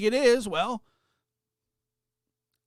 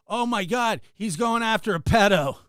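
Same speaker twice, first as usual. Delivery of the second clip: mock outrage; 6 dB louder than usual. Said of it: "and you go".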